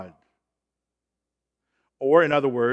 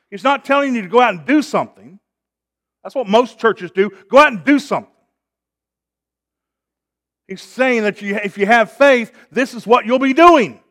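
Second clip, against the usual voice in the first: loud, higher-pitched pulpit delivery; full-force preaching; 5 semitones higher, 8 dB louder than usual. Real, not acted. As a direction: drive the point home to the congregation.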